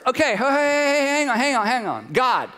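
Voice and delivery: hammed-up self-righteous husband; mock indignant Adam. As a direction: play the offended victim for laughs. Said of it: "In other words".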